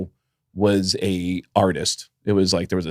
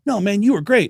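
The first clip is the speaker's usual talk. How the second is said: higher mock reassurance; imitating the band; 11 semitones higher than usual. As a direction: lift the voice way up to mimic them. Disturbed guys reassure, retold.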